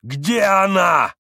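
high, loud, slow demanding shout; slow furious shout. A narrator's reading. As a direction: roar the question at her. Mitya demands.

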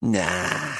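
low, soft, bitter refusal; bitter growl. A narrator's reading. Woman refuses Arcady's invitation, growling bitterly. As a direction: growl it out sourly under your breath. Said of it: "she growled bitterly".

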